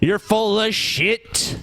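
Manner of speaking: drawn out, word by word; slow emphatic insult. Acted. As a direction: spell it out slowly to him. Repeats insult slowly for emphasis.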